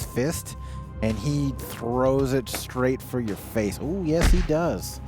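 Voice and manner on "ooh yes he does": ordinary delivery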